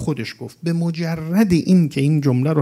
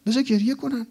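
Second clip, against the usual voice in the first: high plea; 9 semitones higher than usual. Retold or imitated. Prophet defends the mourners.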